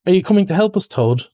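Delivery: sharp, high, stern question; the rat shakes Toad and demands. A narrator's reading. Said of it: "he demanded sternly".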